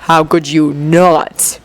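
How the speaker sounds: low, slow and scornful; venomous. Acted. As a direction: sneer it out slowly. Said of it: "tone of voice dripping with venom".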